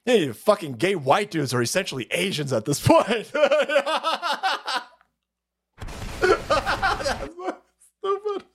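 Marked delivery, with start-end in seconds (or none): 3.3-8.4 high, laughing through the words; cracking up at own joke. Real, not acted. Host laughing at his own joke. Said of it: "laughing at own joke".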